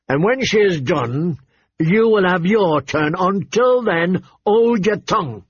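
irritated king. Acted. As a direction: order your son to wait his turn. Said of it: "his voice thick with irritation".

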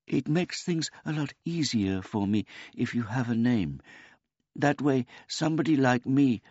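put-on high, polite old man voice; a gentle, childlike old man; simple old man. A narrator's reading.

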